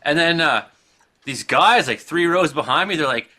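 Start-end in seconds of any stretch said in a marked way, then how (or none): none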